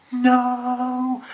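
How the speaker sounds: high, very loud outraged NO; teacher's shocked scream at the wrong card; furious shout. Retold or imitated. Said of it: "the teacher's reaction was like".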